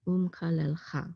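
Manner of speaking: "Um, kalel, cha" blended in an even, careful flow; whole word joined. Shown as a demonstration. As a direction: run "Um, kalel, cha" together as one word. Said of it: "sound it together as one syllable".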